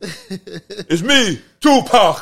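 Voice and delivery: high, loud hammed-up actor line; mocking the actor.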